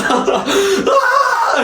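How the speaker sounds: high-pitched mock scream through laughter; imitated scream. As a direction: mimic a friend shrieking with laughter. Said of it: "he literally starts screaming".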